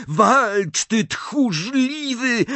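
high, stretched-out yell; shouted insult. A narrator's reading. Harry calling Snape a coward, shouting. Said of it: "zawołał Harry".

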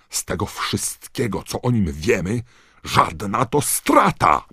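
high, loud, furious; angry outburst. A narrator's reading. Knot exploding at Dumbledore, furious. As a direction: explode at them. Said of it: "wybuchnął Knot".

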